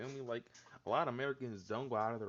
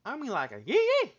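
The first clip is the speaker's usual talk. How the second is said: much higher and louder, a rowdy yee yee; hyped yell; 14 semitones higher, 13 dB louder, faster than usual. Real, not acted. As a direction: belt it out like a hype man.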